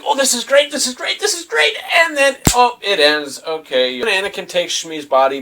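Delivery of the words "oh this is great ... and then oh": much higher, hammed-up delight; mock excitement. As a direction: gush like a thrilled fan. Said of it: "It was just like".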